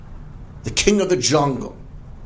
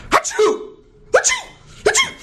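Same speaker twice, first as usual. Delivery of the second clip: very high-pitched mock sneezing; three squeaky, sudden achoos; fake sneezes; 5 dB louder than usual. Fox pretending a cold to the lion, squeaky fake sneezes. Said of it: "the fox begins to sneeze".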